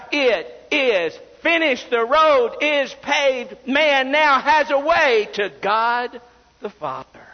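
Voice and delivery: high, slow triumphant proclamation; ringing preacher's declaration; crying out. Acted. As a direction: cry it out from the pulpit, each phrase drawn out. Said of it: "he cried out".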